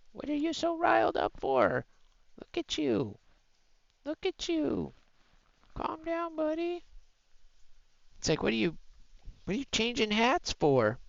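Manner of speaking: very high, soft sing-song puppy voice; squeaky pet-talk voice. Real, not acted. Baby-talking the riled dog.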